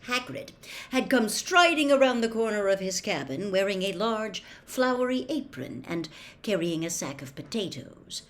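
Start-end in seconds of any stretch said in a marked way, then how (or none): none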